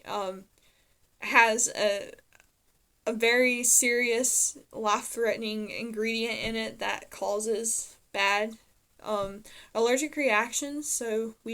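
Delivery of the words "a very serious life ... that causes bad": ordinary talk, nothing marked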